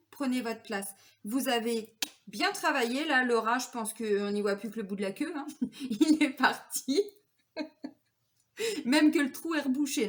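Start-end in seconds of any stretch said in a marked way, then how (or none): 5.6-10.1 laughing. Real, not acted. Laughing at the card image.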